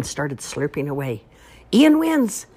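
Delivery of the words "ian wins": loud, high announcement; winner shout. Acted. Grand marshal declaring the spaghetti winner, shouting. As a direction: call out the winner proudly. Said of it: "Missus Hogan Mueller shouted".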